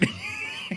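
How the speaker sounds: high breathy laugh; real laugh. Real, not acted. Storyteller amused by his impression, real high laugh. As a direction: laugh at your own impression.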